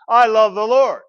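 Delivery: heartfelt declaration. Shown as a demonstration. Preacher showing the congregation how to say it, heartfelt. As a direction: say it loud and from the heart. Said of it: "Practice saying that".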